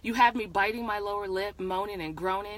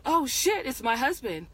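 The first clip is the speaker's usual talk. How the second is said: high, louder burst of panic, easing off; startled cry, acted in narration; alarmed mock scream; 5 semitones higher, 4 dB louder than usual. A narrator's reading. Wife caught with lover as husband knocks, alarmed mock scream. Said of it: "And I scream".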